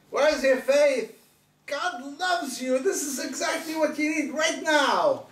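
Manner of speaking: high, rising sermon peak; preacher rising to a fervent peak; fervent preaching. Acted. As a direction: press the faith point home. Preaching at full force.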